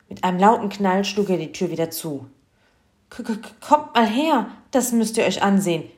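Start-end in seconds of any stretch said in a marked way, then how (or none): 3.1-5.9 loud, high, agitated stutter; stammer, then call the family over urgently; excited stammering call. A narrator's reading. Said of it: "rief er aufgeregt". (aufgeregt stotternd gerufen)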